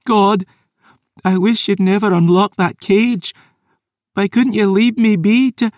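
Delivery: grieving, furious maid; tearful rage. Acted. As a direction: break down while shouting. Tears falling, glaring.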